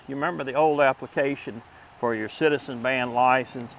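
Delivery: ordinary delivery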